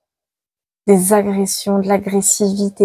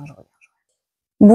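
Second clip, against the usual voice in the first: called out louder as she steps away; calling out; 7 dB louder than usual. Real, not acted. Stepping away from the camera, tells viewers she is coming back, calling out. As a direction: call it back over your shoulder.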